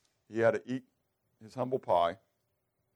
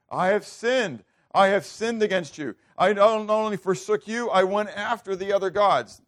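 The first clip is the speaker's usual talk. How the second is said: pleading confession; 6 semitones higher, 6 dB louder than usual. Acted.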